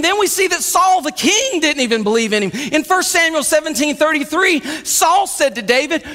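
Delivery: ordinary talk, nothing marked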